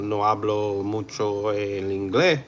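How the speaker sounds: put-on heavy Spanish accent; mock Spanish accent. Shown as a demonstration. Streamer answering chat's request, thick mock Spanish accent. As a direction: ham up the Spanish accent for chat. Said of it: "I can do that too".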